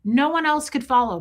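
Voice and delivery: usual speaking voice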